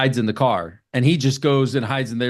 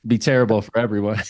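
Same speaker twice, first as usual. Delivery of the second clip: ordinary delivery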